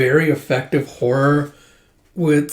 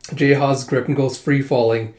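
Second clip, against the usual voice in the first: usual speaking voice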